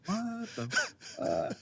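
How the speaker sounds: hushed, dropped aside; under-breath aside. Retold or imitated. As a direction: keep it to yourself, barely voiced. Silent reaction in meeting.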